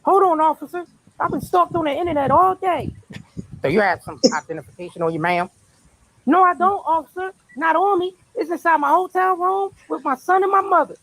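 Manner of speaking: high put-on voice. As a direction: play the startled suspect. Acting arrest skit.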